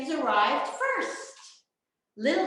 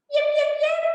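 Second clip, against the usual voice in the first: fox yips; 8 dB louder, much faster than usual. Acted. Little fox calls toward the music, yipping high. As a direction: call out like a small fox, much higher. Fox gives duck a valentine.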